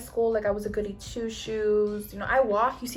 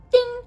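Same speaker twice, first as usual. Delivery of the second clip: high, loud "ding"; imitated ding; 10 dB louder than usual. Storyteller punctuating her point about people high, imitated bell ding. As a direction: pop out a sudden bell noise.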